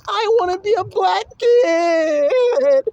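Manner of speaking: high, loud bawling, hammed up; long put-on sobbing whine; mock crying child. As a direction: wail it slowly like a tantruming kid. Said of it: "he's crying".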